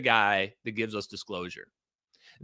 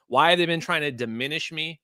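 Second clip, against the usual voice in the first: ordinary talk, nothing marked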